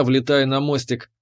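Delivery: plain reading voice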